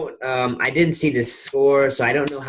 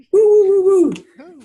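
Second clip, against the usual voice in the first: high, loud playful woofs; imitated dog barks; 6 dB louder than usual. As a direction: bark like a puppy for a baby. Dog sound for baby.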